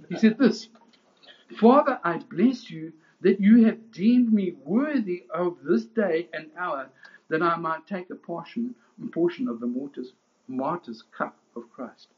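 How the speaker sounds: high, loud, driving pulpit proclamation; preaching at full force. Acted. Preacher to his congregation, at full force. Preacher voicing the martyr's prayer.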